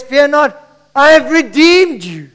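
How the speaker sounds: ringing declaration in God's words; loud proclamation. Acted. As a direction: proclaim it to the whole church. Proclaims quote, then repeats it down.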